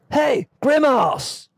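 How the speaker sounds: high, slow Australian lifeguard shout; Australian accent. Retold or imitated. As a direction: call out in an Aussie accent. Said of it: "I can't do anything but an Australian accent there".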